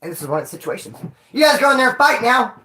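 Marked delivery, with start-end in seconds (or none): 1.3-2.6 loud, higher voice urging a fight; shouting inmates. Retold or imitated. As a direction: bark it like the crowd egging them on.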